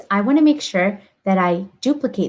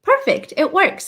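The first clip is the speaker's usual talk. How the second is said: excited; 9 semitones higher than usual. Real, not acted. Code test succeeds.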